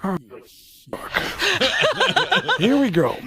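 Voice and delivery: a light Pacino voice that rises into laughter; a resigned, laughing Pacino; Al Pacino impression. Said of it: "and Al Pacino".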